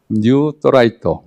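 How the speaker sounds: Japanese-style reading of 또라이또, slow and drawn out; Japanese accent Korean. Shown as a demonstration. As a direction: read 뉴 또라이또 the Japanese way, slowly. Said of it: "일본 발음으로 읽어주자".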